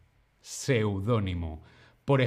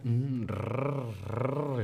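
soft imitated cat purr, rolled rrr; 6 dB quieter, much slower than usual. Shown as a demonstration. Teacher imitating her purring cat, softly. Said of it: "es lo que hacen los gatos".

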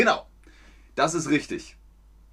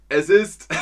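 laughing high and loud; giggling through the words; real laughter; 7 dB louder than usual. Real, not acted. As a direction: burst out laughing at the gif. Laughing at own gif.

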